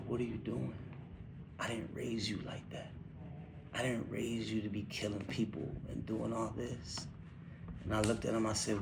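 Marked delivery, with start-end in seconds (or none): 0.1-7.1 soft, half-whispered father scolding his son; hushed retold rebuke. Dad scolding his son for killing people, in a soft half-whisper. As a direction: hush it down, like the words still sting.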